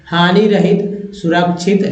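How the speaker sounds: usual speaking voice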